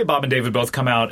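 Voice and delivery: ordinary talk, nothing marked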